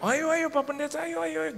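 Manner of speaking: high, excited chorus of agreement, imitated; eager friends. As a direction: mimic keen companions in a high voice. Teman menyambut ajakan makan.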